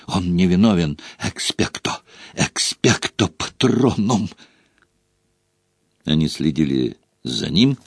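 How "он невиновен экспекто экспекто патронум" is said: gasping, fading cry; breathless plea. A narrator's reading. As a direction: plead while out of breath. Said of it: "задыхаясь, кричал Гарри".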